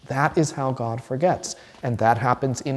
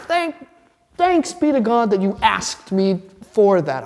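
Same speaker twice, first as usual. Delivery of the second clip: much higher, beaming delight; 8 semitones higher than usual. Retold or imitated. God answering the believer's request: high, overjoyed reply. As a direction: burst out happily. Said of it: "He says".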